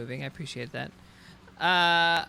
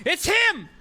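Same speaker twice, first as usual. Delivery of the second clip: high, loud excited guards spotting him; hammed-up high enemy cry; mock enemy shout; 6 dB louder than usual. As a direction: yell it like a mob that just found its target. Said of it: "they're all like".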